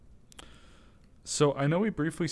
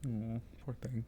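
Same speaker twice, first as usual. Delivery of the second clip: softer, hushed, dropping to a whisper at times; soft soothing coax; 3 semitones higher, 13 dB quieter than usual. Real, not acted. Luring the dust bunny out, hushed soothing voice. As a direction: soothe a frightened animal, barely above a breath. Soothing skittish creature softly.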